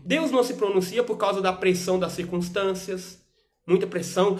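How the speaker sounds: fiery pulpit exhortation swelling up; preaching. Acted. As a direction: preach it harder and harder. Preaching with mock plea.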